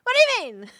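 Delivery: very high, exasperated comic squeal; baffled creature. Pretend. Mimic creature stumped by the German, high-pitched and bewildered. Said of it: "The v-, the visual of it being like".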